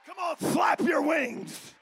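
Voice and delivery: ordinary delivery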